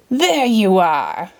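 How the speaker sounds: slow, imperious queen voice; bellowing queen. A narrator's reading. The Queen spots Alice, imperious bellow. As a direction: boom it out like a queen. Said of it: "bellowed the Queen of Hearts".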